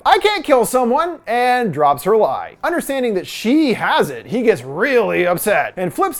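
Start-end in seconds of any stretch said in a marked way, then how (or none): 0.0-1.2 higher-pitched impulsive outburst, mildly acted; lightly acted blurt. A narrator's reading. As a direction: blurt it out as a narrator would. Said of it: "Rose reactively blurts out".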